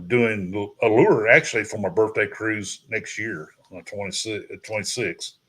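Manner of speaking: normal talking voice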